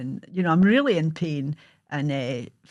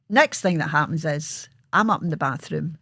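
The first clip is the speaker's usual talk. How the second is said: ordinary delivery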